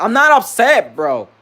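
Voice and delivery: loud, high-pitched protest; exasperated denial. Retold or imitated. Worker told they seem upset, loudly exasperated. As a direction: burst out insisting on it. Retold denial escalates into outburst.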